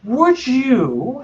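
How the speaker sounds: creature voice. Acted. GM voicing Gribblegast.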